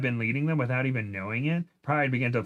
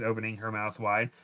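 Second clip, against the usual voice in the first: ordinary delivery, nothing marked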